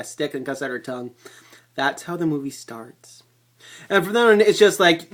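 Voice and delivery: usual speaking voice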